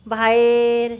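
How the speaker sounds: ordinary talk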